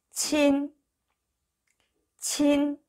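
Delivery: 친 stretched high and level; teacher's sustained 친; model pronunciation. Shown as a demonstration. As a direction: hold the vowel of 친 on an even high note. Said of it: "이렇게 발음하시면 됩니다".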